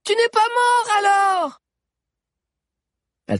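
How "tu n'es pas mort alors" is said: high, loud shout of relief; excited shout. A narrator's reading. Chrysante shouts to Zizo, amazed he is alive, high and loud. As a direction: shout it with joyful disbelief.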